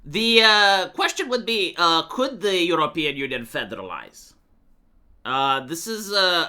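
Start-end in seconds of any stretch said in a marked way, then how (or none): none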